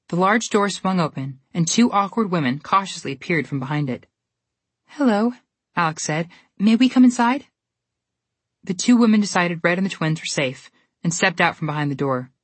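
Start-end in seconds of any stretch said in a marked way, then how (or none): 4.9-7.5 put-on bright polite girl. Girl greets the women.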